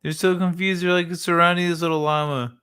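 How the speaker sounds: normal talking voice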